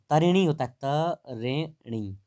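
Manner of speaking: तरिणी spoken slowly, syllable by syllable; drawn-out spelling demo. Shown as a demonstration. Teacher spelling out the word for a class, drawn-out syllable demo. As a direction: break तरिणी apart, stretching each syllable. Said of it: "ड़ी से ऐसे छोटी की चढ़ जाएगी".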